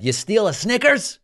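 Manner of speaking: high-pitched mock outrage; mock incredulity. Host mocking killing shoplifters over candy, mock incredulity. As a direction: pitch jumps up in exaggerated disbelief.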